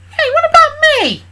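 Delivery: squeaky little sidekick, high and loud; tiny high voice. Acted. Little Nifty pipes up.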